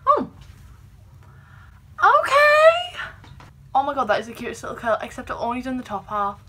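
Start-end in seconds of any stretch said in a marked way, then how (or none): 0.1-3.0 squeal. Real, not acted. Delighted at a curl working.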